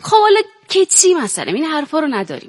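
put-on high Azeri-accented protest; Ardabili Turkish accent. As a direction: brush it off in a Turkish-accented Persian. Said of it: "یه مقدارشو با لهجه بازی بکنید".

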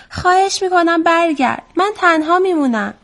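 high-pitched storybook plea; pleading call. A narrator's reading. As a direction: call a friend back, lightly acted.

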